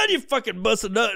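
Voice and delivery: ordinary talk, nothing marked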